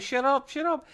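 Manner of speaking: high-pitched mock-urgent silencing; panicked hushing. Acting out Mariner silencing talk of Q, high and urgent. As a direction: shush them in a high, urgent voice.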